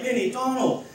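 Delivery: high call out to friends; Mickey stuck in glue calling his friends, high mock shout; mock cry for help. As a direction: call for rescue, pitched well up. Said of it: "he starts crying for help".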